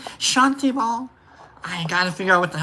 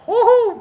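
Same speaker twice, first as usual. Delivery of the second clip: high, loud whoop; woo-hoo; 14 semitones higher, 5 dB louder than usual. Real, not acted. Host recalls pulling the trigger on a breakup, high whoop.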